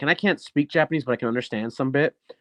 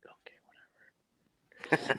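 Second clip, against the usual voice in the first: barely-there whispered okay, giving up; defeated whispered shrug; resigned whisper; 12 dB quieter, much faster than usual. Retold or imitated. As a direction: breathe it out like you've stopped arguing. Giving in to an unwanted spot.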